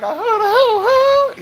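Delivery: slow, very high falsetto hoot; mock astonishment. Repeating the bathhouse discovery, falsetto mock astonishment. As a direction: draw out the hoot in a silly high voice.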